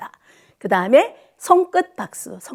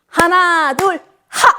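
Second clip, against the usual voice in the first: counts called higher, ending in a louder shout; counting clap demo; 5 semitones higher, 3 dB louder, slower than usual. Shown as a demonstration. 합장 박수 시범 보이며 힘차게 세는 구령. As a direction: count it out and punch the last beat. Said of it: "어떻게 하냐면".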